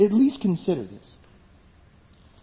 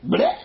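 a high comic retch, Bleh spat out; cartoon retch, much higher; vomiting noise. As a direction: make God spit him up with a high gag sound. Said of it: "God says".

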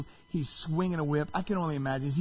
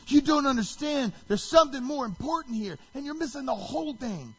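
raised, high-pitched exasperated rebuke; angry; 8 semitones higher than usual. Retold or imitated. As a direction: insist heatedly, acting out an angry Jesus. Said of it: "this anger coming out of Jesus".

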